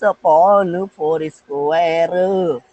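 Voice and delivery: very slow, long-held syllables, as if writing along; drawn-out dictation. Acted. Teacher mimes pupils writing the formula, drawn out. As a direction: stretch every word very slowly.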